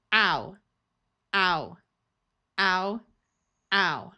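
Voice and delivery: Ow drawn out as a clear ow vowel; the ow sound. Shown as a demonstration. Teacher demonstrating the ow sound to learners, clear and drawn out. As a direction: say Ow slowly as a rounded ow. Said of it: "The same sound as this".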